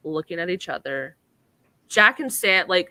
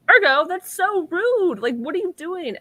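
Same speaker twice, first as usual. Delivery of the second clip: scolding; 11 semitones higher, 5 dB louder than usual. Retold or imitated. Sam scolding Ergo for being rude, high shocked. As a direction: tell him off in disbelief. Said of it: "Sam was like".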